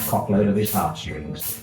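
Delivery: ordinary delivery, nothing marked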